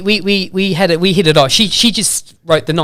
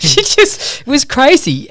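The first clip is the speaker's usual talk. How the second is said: laughing; 10 semitones higher, 3 dB louder, slower than usual. Real, not acted. Laughing about her loving it.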